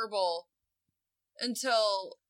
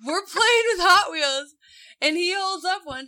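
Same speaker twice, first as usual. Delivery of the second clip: usual speaking voice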